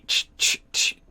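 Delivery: voiceless repeated "ch" bursts; imitated skating noise. Game master showing skates going all the way back, sound effect. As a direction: make short whooshing skate sounds with the mouth.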